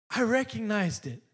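imitated Irish lilt; Irish accent. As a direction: imitate an Irishman, pitch lifted. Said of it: "He's got this great accent".

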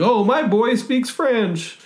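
high, slow Homer voice; proud Homer. Acted. Homer at the dinner table, high, slow and impressed. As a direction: draw it out as an amazed dad. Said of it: "Homer says something".

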